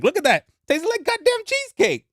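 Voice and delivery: high-pitched, hammed-up outburst; mock amazement. Co-host raving about crazy beers, high mock amazement. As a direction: exclaim it way up high, comically amazed. Acting out amazed beer taster.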